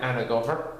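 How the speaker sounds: usual speaking voice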